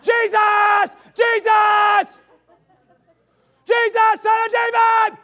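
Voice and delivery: high, full-voice shouting of Jesus; screaming out. Retold or imitated. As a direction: scream desperately over the crowd. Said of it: "He starts screaming out".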